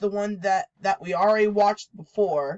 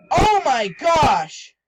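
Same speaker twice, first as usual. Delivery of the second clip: startled gasp; 6 semitones higher, 8 dB louder, much slower than usual. Real, not acted. Jump scare reaction.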